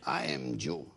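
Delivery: put-on deep, low Mexican-accented voice. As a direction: drop way down into the creepy Mexican man. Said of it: "He goes".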